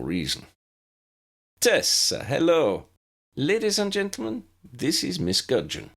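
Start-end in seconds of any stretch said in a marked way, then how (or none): 1.6-5.9 smooth, higher, lightly Italian greeting; caramel-smooth voice with a touch of Italian; hint of Italian accent. A narrator's reading. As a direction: charm the class like a suave professor. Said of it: "in a voice as warm and smooth as caramel, spiced with a hint of an Italian accent".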